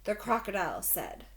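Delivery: usual speaking voice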